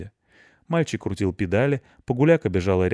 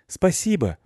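put-on boy's voice; 10 semitones higher, slower than usual. Boy thanks dog at gate.

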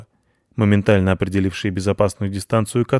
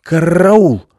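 high, loud growly yell; frantic guard-dog cry; dog raising alarm; 9 semitones higher, 9 dB louder than usual. Acted. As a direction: bark out the alarm, rolling the R.

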